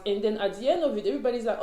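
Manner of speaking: ordinary delivery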